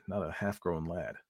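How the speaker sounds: plain reading voice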